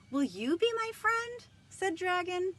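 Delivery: high, loud, pleading Dragon voice; hopeful dragon. Acted. Dragon asking the squirrel for friendship, high and hopeful. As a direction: make him sound eager for a friend.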